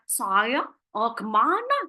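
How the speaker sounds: "Saya" rounded into "soyo", mock-cool; mock trendy talk, vowels rounded and drawn; unclear affected articulation. Shown as a demonstration. Trainer showing interviewees what to avoid, affected unclear vowels. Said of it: "tapi malah bahasanya nggak jelas".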